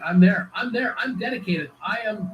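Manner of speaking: playfully overeager, volunteering for the job; hammed-up keen volunteer, pitched high; mock eager.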